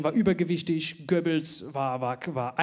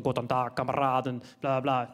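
lower, mock speech voice; Hitler speech; 5 semitones lower than usual. Video of Hitler speaking to the class, mock dictator voice. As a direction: ham up a dictator's address. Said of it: "Der so".

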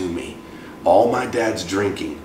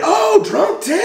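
very high mock cry of recognition; sudden delighted recognition; slower than usual. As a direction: exclaim it like the penny just dropped, voice way up. Receptionist suddenly recognizes Tim, retold.

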